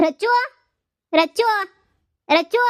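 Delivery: calling loudly across the house, rising. Acted. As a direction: call her name from another room. Calling wife from another room.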